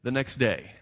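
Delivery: ordinary talk, nothing marked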